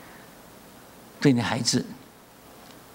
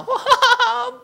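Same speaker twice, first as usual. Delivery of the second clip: much higher, louder mock wail; imitated wail; 6 dB louder than usual. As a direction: let out a high mock cry. Crying sister imitated.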